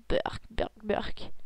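soft breathy burk of disgust; muttered disgust. Acted. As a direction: spit out the taste quietly. Coca tastes bad.

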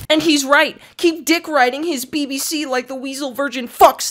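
high, loud mock-outraged fanboy; mocked ranting troll. Reading out a commenter's attack, mock ranting troll. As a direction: imitate a hateful commenter at full tilt. Reads hostile comment in mocking voice.

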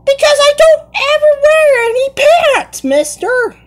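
squeaky high put-on kid voice; high cartoon voice. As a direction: play it like a cheeky cartoon kid. Kid playing in costume voice.

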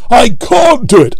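high, strained straining puffs; puffing Gordon. A narrator's reading. Gordon straining up the hill, puffing high and strained. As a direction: gasp it out in rhythm. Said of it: "they puffed together".

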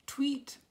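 high, loud spoken tweet; short piercing-pitched whistle noise; whistle blast. A narrator's reading. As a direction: imitate a police whistle. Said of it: "she blew her whistle".

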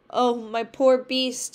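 crying out. A narrator's reading. Beauty cries out over her dreamed Beast, loud and slow. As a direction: cry out slowly for the poor creature. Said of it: "she cried".

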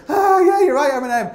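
high, loud burst of joy; elated shout. Retold or imitated. Climber hearing the song in his head, elated shout. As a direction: cheer yourself on at full volume. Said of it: "I was like".

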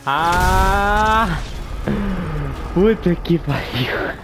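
loud drawn-out curse; frustrated yell. Real, not acted. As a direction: yell at the crash. Crashes in the race.

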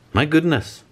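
high startled exclamation; cried out. Acted. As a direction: gasp it out in shock. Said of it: "she cried".

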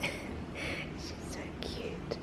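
very slow hushed whisper. Real, not acted. Whispering about the cute pet so as not to wake her. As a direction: barely breathe the words.

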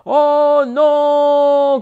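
high loud cry of dismay. A narrator's reading. Father crying out as the crowd carries him. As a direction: yelp it out. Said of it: "cria son père".

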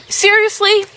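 high incredulous question; incredulous at the quiz. Real, not acted. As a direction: pitch it up in disbelief. Reacting to option C.

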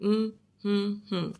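humming a word's syllables, one hum each; three separate hums. Shown as a demonstration. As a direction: hum three short, even beats. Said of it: "we must hum it".

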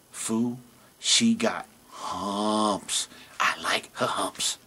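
soft scoff, then slow low whisper; camel voice. Acted. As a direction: lovestruck camel, slow and hushed.

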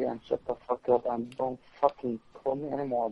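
ordinary talk, nothing marked

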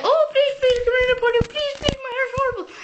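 high, begging whine, rushed at the end; a desperate client pleading with the hairdresser, high and whiny; pleading customer. Retold or imitated. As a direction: beg the hairdresser to fix your hair.

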